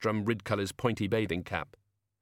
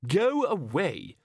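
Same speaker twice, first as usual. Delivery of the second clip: higher, drawn-out order to leave; exasperated dismissal; 7 semitones higher, much slower than usual. Acted. Ridcully sends the unwanted gnome away, exasperated and drawn-out. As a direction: drag it out, slowly and emphatically.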